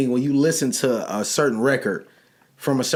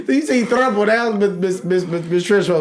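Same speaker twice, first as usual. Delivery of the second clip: laughing; 6 semitones higher than usual. Real, not acted. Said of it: "Sound like Miss Trenchbolt".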